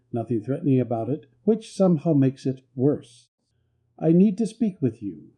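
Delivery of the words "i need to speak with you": plain reading voice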